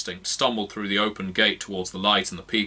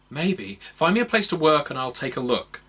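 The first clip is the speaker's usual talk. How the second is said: elf healer; 6 semitones higher than usual. Acted. Elf agrees to heal.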